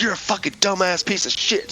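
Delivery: mock anger. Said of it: "I was so mad at this motherfucker".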